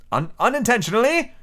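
high, rising, disbelieving query; startled question. Acted. Narrator's startled question back to Nemo.

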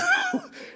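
a burst of high-pitched laughter; a high laugh while recalling a dad's antics. Real, not acted. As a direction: laugh high at your own story. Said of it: "they weren't laughing any longer".

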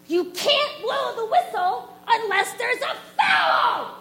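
high, loud yell in the player's voice, half laughing; retold furious scream. Raging player screaming at the ref over the whistle, loud and high. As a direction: belt it out like a raging six-foot-four player. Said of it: "and he screams at me".

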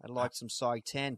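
put-on soft, slow Australian accent; quietly drawn-out Aussie request. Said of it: "You're good at that Australian accent".

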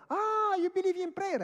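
high, loud mock exclamation; delighted surprise. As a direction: burst out high in amazement. Retold lady's delighted surprise.

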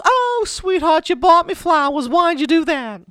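husband plays his wife, high and gushing over flowers; delighted wife. Acted. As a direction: coo it like a thrilled wife. Husband acts out wife's reply.

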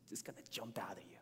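fast excited whisper. Real, not acted. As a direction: hush it like a secret bursting out. Excited secret aside.

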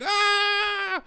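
high, short monstrous cry; creature wail. Retold or imitated. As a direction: let out a mock monster shriek. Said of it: "we hear voices like".

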